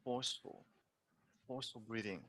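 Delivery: soft demo. Shown as a demonstration. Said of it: "we say forceful".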